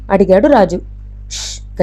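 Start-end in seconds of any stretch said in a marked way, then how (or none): 1.3-1.8 soft, unvoiced hushing sound; whispered shush. A narrator's reading. Woman silencing captive Raju in the dark, whispered shush. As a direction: hush him softly.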